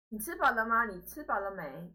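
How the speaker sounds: normal talking voice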